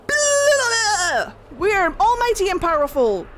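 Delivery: high, loud mocking boast; pompous gods. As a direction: puff up like arrogant gods. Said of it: "the Evan Uris were like".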